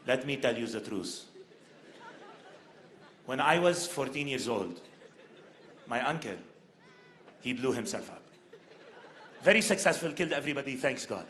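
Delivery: low, soft mock-solemn Lebanese accent; grave confession as an old Lebanese man; super serious. Old man gives BBC his final words, super serious. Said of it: "he gets super serious".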